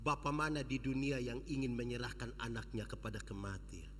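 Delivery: low, soft comforting father; tender father. Acted. Father comforting his son, soft and tender. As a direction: stroke the child's head with the voice. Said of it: "dia pun bapa bilang begini".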